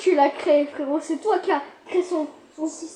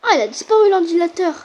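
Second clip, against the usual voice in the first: ordinary talk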